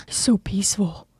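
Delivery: hushed whisper so as not to wake him; whispering Shadow. Acted. Shadow carrying a sleeping Cookie home, tender whisper. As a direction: breathe the words, careful not to wake anyone.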